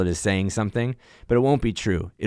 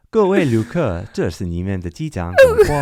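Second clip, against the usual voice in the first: flight attendant-like announcement; 2 semitones higher, 6 dB louder than usual. Shown as a demonstration. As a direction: announce it in Mandarin to the passengers. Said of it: "Now you sound like the flight attendant".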